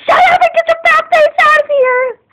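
high, loud yell to get out; furious shout. Real, not acted. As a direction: scream him out of the room. Fighting with brother.

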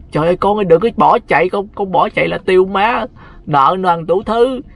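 frantic mother holding back the bride; pleading mother. Retold or imitated. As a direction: plead with your daughter not to run off. Bride's mother begging her to stay.

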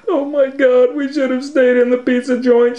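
high, loud crying wail; high tearful panic; crying Shaggy. Acted. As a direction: wail it trapped and scared. Trapped in attic.

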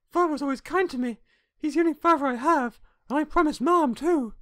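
put-on high-pitched, pleading girlish voice; young girl, earnest. Girl defending her father to the salesman, earnest. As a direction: play a loyal little daughter.

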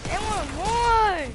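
hype shouting. Real, not acted. Hyped fight calling.

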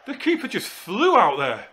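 high, loud and slow, still stunned; amazed. Real, not acted. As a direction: drag it out in disbelief. Still reeling from the keeper's rush.

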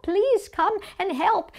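high, slow, desperate appeal; crying out for help. Acted. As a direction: call guards to a hurt cellmate. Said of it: "We were crying out for help".

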